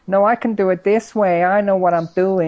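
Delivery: high, loud mock child voice; obnoxious American kid. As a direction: imitate a bratty know-it-all American kid. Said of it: "A fucking eleven-year-old American kid".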